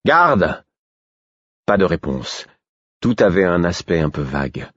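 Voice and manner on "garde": appeler fort, voix haute; héler le garde; cri d'appel. A narrator's reading. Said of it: "criai-je". (appel crié)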